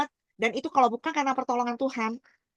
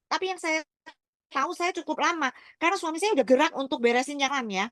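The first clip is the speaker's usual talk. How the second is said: ordinary talk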